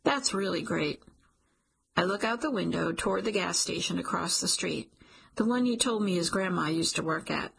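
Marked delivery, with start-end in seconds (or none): none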